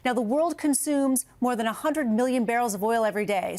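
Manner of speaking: usual speaking voice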